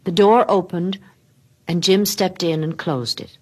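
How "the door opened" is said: usual speaking voice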